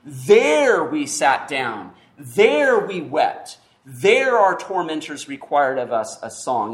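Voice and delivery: THERE leaned on at the start of each line, slowly; slow, weighty reading with "There" stressed each time. Shown as a demonstration. Preacher reading the psalm to his congregation, stressing "There" slowly. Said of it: "there's emphasis in the Hebrew".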